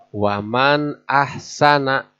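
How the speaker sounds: Waman ahsana with the nun distinct, said slowly; correct clear reading. Shown as a demonstration. Teacher models the correct idhar reading slowly. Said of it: "Harus jelas".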